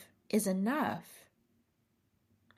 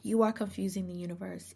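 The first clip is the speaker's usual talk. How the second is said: ordinary delivery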